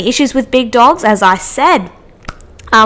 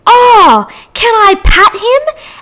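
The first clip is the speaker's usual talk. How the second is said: high, loud, drawn-out coo; gushing shop lady; 11 semitones higher, 5 dB louder, slower than usual. Retold or imitated. Shop lady cooing at the puppy, high, loud and slow. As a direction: coo it like you can't resist the puppy. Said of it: "and goes".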